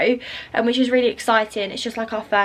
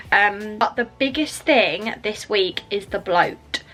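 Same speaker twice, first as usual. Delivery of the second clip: ordinary delivery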